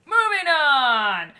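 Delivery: loud, slow, stretched call; drawn-out announcement. Acted. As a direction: boom it out like a show host. Echoing co-host, announcing next segment.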